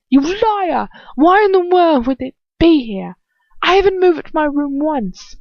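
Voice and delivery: high, loud raging accusation; furious yelling. Acted. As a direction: roar it at her. Character rages at narrator.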